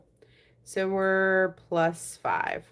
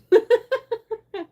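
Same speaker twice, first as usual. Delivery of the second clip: high delighted laugh; 7 dB louder than usual. Real, not acted. Laughing after learning the name.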